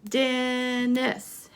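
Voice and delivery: Dennis drawn out in two beats; measured Dennis for counting; syllable by syllable. Shown as a demonstration. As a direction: hold the first syllable of Dennis. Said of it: "let's count the syllables".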